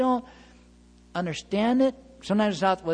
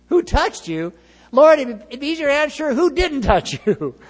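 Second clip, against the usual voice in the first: higher, louder scoffing question; laughing disciple disbelief; mock incredulous disciples; 9 semitones higher, 8 dB louder than usual. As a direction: ham up the disbelief.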